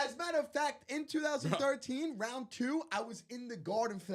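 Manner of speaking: high, insistent self-defence; heated rebuttal. Real, not acted. Fan proves he was in the Garden, heated and high.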